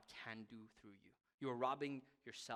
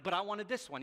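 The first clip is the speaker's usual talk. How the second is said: a raised, pleading mock protest; whining as himself; 7 semitones higher, 8 dB louder than usual. Pastor retelling his complaint to God, mildly whiny. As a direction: play yourself begging to keep it. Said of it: "I'm like".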